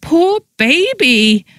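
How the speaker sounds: high, drawn-out mock wail; hammed-up sobbing pity, slow; pretend wail. A narrator's reading. Friend teasing released prisoner with pretend wail. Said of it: "pretended to wail".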